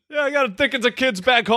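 high, joking New York voice; mock New York accent. Friend plays Dickens bragging about kids, mock New York accent. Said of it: "do it in the New York accent".

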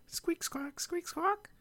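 high squeaky bird noises; parrot squawk. Pretend. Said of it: "why do you make a squeak, squawk noise".

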